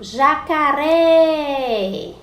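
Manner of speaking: "jacaré" called high and loud, RÉ held long; "jacaré" with a long, loud final RÉ; calling out the word. Shown as a demonstration. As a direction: stretch the last syllable of "jacaré". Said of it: "a sílaba mais forte foi o ré".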